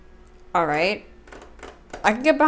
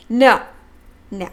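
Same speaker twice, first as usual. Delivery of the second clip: normal talking voice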